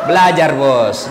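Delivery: laughing through the punchline. Real, not acted. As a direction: chuckle out the moral.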